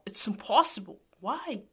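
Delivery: ordinary talk, nothing marked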